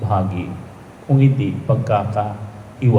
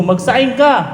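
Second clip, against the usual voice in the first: higher, louder mock wife's command; mock wife order; 13 semitones higher, 4 dB louder than usual. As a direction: bark it like a bossy wife, hammed up. Wife voiced in joke.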